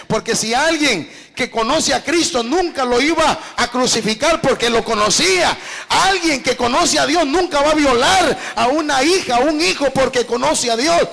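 loud, impassioned sermon with hard-hit key words; forceful preaching. Real, not acted. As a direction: drive the message home to the congregation at full voice. Preaches forgiveness at full force. (prédica enérgica a viva voz)

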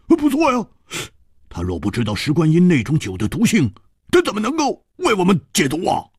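excited agreement. Acted. Hu Tiehua voiced.

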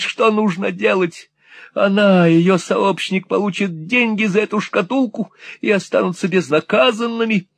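put-on a robbed old man shrieking in panic; frantic old miser. A narrator's reading. Old miser frets over evidence.

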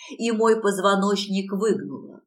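usual speaking voice

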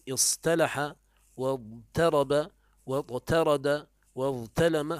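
slow اصطلح واضطرب واضطرد واضطلم, each with a light plain t; original forms. Shown as a demonstration. Teacher gives their origins, اصطلح واضطرب واضطرد واضطلم with light t. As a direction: draw out اصطلح واضطرب واضطرد واضطلم, t unemphatic after each held consonant. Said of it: "وأصله".

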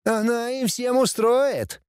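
high, drawn-out gloating; threatening promise, bratty prince. A narrator's reading. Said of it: "пообещал принц". (злорадно обещая, высоким голосом)

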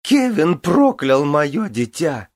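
high, loud and slow shrill wail; shrill screeching bird woman. Acted. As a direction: screech each word, drawn out. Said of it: "пронзительно завизжала Гвенвифор".

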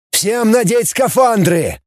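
high, drawn-out order; roaring command. A narrator's reading. Commander orders all volunteers into suits, urgent roar. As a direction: call it out to the whole crew. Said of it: "ревел флагман".

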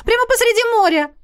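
high, excited chatty mother; mother gushing. Acted. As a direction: gush like a thrilled mother. Mother gushing about island. (восторженная мама, высокий голос)

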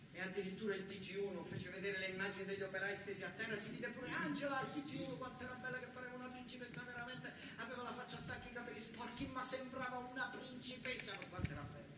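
ordinary talk, nothing marked

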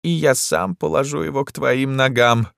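high, affected vow; theatrical courtly devotion; adoring emperor. A narrator's reading. Emperor figure vowing devotion.

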